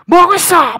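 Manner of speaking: very loud, high scolding yell; sudden high, booming shout; acted impatient outburst. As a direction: explode at someone who is dawdling. Acted outburst against the calm.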